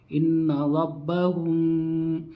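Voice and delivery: इन्ना रब्बहुम chanted slowly, the मीम hidden and hummed through the nose; nasal ghunna with ikhfa. Shown as a demonstration. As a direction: hold a nasal hum on the मीम of रब्बहुम before the बा. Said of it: "गुन्ना के साथ इख्फा कर रहा हूँ".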